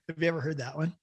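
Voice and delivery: ordinary delivery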